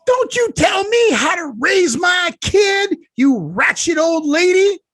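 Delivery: high, loud furious shout; imitating the mom blowing up at the old lady; screaming mom. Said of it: "And the lady went off".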